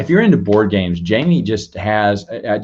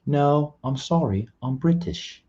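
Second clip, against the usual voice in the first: bad British accent, higher and soft; Luke; 6 semitones higher, 4 dB quieter, slower than usual. Playing a friend turning down an invite to join, hammed-up British accent. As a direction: put on a terrible mock-British voice. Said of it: "That's a terrible Luke impersonation".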